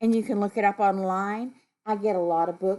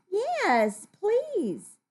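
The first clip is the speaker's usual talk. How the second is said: high, lilting polite yes; polite acceptance; 11 semitones higher than usual. Acted. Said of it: "so you say".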